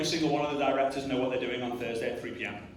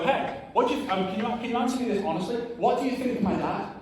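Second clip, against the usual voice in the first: higher, fast, eager asking; eager question; 7 semitones higher than usual. Retold or imitated. Son eagerly asking dad's admirers about him, higher and fast.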